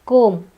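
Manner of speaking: comb ending on M, no B; silent B. Shown as a demonstration. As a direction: say comb as kome. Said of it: "In the same pattern".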